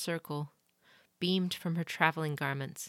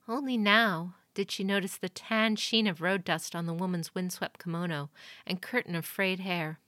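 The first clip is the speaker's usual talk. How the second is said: plain reading voice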